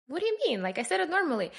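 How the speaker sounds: high-pitched mock innocence; retold defensive surprise. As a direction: imitate a puzzled, defensive friend. Russian friend defending herself in retold exchange.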